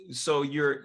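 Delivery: normal talking voice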